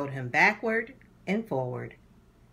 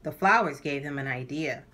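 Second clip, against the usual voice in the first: usual speaking voice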